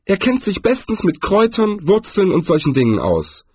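high-voiced, cheerful tourist; upbeat, high-pitched character voice. A narrator's reading. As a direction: recommend a friend happily in a high voice. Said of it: "sagte Zweiblum fröhlich".

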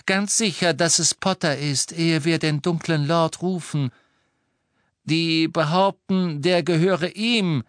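high, cold-voiced lady; cold, clear voice. A narrator's reading. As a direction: speak coldly and clearly, pitched high. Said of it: "mit ihrer kalten, klaren Stimme". (kalte, klare, hohe Stimme)

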